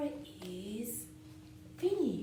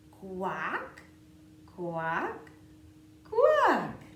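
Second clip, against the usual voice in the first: duck quacking; 10 dB louder, much slower than usual. Pretend. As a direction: quack like a playful duckling. Duck refrain.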